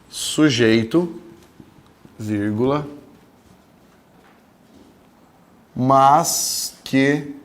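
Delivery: word by word, very slow dictation. Acted. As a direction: dictate so students can write it down. Dictates a definition to the class. (ditado lento, palavra por palavra)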